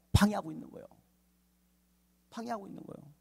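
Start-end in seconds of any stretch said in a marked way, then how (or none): 2.3-3.1 much softer, higher echo; near-whispered repetition for effect; hushed repeat. Real, not acted. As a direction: let it sink in softly.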